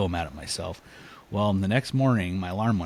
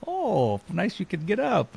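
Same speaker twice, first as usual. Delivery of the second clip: high, sarcastic jibe; mocking mouthing off; 12 semitones higher, slower than usual. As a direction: imitate buddies razzing someone, pitched way up. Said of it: "they're mouthing off".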